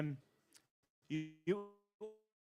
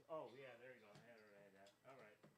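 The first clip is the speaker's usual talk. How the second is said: ordinary delivery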